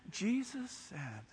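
very soft and slow; hushed after yelling. Shown as a demonstration. Preacher apologising for yelling, exaggeratedly quiet. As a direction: drop right down to a gentle, slow hush. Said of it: "Sorry, I'm so excited about this stuff".

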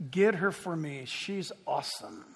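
hushed, low-pitched retold aside; low confidential order. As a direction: drop it low, as if to an assistant. King privately orders his assistant.

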